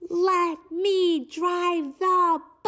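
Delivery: high, slow drawn-out begging; pigeon plea. Acted. Pigeon making one last plea to the reader, high and slow. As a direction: stretch out the plea.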